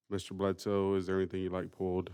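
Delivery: ordinary talk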